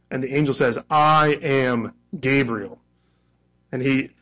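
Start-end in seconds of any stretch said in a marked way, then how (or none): 0.9-2.8 slow "I am Gabriel" with "I" hit hard; emphatic I am. Shown as a demonstration. Angel answering Zechariah, slow and emphatic. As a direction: plant "I am" in "I am Gabriel" like a declaration. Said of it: "this emphatic "I am".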